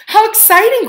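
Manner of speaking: bright high cry of delight; excited grandma. A narrator's reading. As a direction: squeal it with pleasure.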